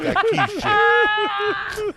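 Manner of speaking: high laughing squeal. Real, not acted. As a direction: laugh through the words.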